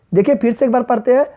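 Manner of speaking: ordinary talk, nothing marked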